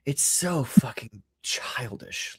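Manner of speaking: slow disgusted whisper. Real, not acted. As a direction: breathe it out in disgust. Disgusted aside under breath.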